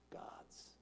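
breathed out in a whisper; the last word barely breathed. Acted. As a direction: drop to a scornful whisper. Whispered mocking end.

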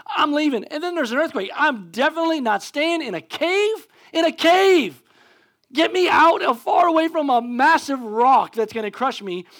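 high, loud mock panic; acted freaking out. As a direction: play someone desperate to flee the cave. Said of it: "now I'm freaking out".

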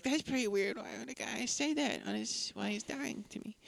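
high, soft bewildered voice; mock puzzled murmur. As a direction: mutter it puzzled. Heroine puzzled, retold.

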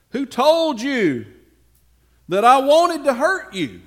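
indignant demand. Acted. David protests his innocence to Saul, loud indignant.

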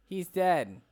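ordinary delivery

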